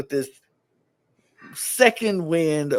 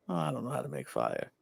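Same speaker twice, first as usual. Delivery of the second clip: soft, quick, lower pretend confession; feigned helplessness; 6 semitones lower, 13 dB quieter, faster than usual. Sam playing clueless as a mind game, soft feigned helplessness. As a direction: toss it off softly as an act. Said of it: "But then act like".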